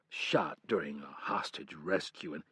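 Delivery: low, soft and slow; a hint of shame; defeated, embarrassed. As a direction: confess it reluctantly, deflated. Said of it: "Defeated and embarrassed".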